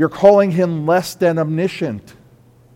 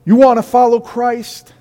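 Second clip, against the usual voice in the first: much higher and louder fiery preaching; full-force preaching; 8 semitones higher, 6 dB louder than usual. Acted. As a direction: drive the warning home to the congregation.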